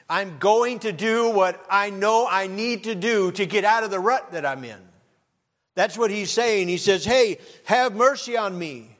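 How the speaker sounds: high, loud, ringing preacher's cry; full-force preaching. Acted. Preacher rousing the congregation to change, at full force. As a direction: preach it out to the whole church.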